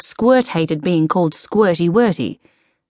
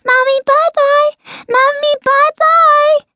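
very high, loud, excited squeal; piercingly high squeaky cheer; squeaky little creature; 5 dB louder than usual. A narrator's reading. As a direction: squeak it out like an overjoyed toddler. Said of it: "squeaked Squeak".

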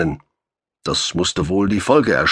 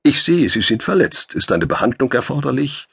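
ironic question; 8 semitones higher than usual. A narrator's reading. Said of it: "entschloss er sich zu einer ironischen Frage".

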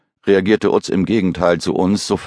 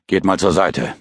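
curt order. Acted. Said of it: "befahl Utz knapp".

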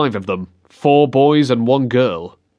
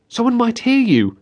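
put-on higher girl's voice, a touch worried; lightly voiced girl; 10 semitones higher than usual. A narrator's reading. Girl warning the boy they may be heard, slightly anxious. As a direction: voice the girl, nervous and careful.

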